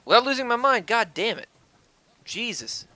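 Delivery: loud, high-pitched exasperation; real frustrated outburst. Real, not acted. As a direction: burst out loudly, losing patience with the game. Startled, frustrated mid-game outburst.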